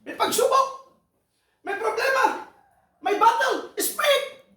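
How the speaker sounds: high, loud preacher's build; rally the congregation to pray; preacher shouting each trial in turn; fiery preaching. Acted. Preaching at full force.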